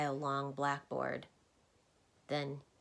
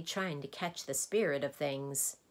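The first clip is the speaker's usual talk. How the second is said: ordinary delivery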